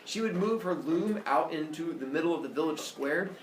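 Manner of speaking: ordinary delivery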